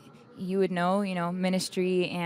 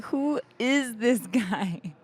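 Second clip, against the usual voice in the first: slow, higher, mock-indignant outburst; mock outrage, slow and raised in pitch; retold fuming anger; 4 semitones higher, much slower than usual. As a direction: act out seething disbelief, drawing the words out. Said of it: "the anger inside of me is just fueling, raging".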